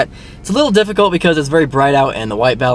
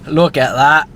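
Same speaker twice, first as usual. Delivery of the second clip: mock Cockney accent; slower than usual. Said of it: "not sure if I'm doing a Cockney accent".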